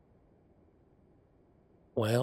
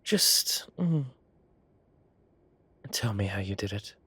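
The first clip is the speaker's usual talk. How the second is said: normal talking voice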